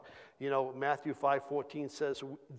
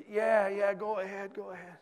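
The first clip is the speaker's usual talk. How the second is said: imitated distraught consent; 6 semitones higher, 3 dB louder than usual. Distraught driver agrees to prayer.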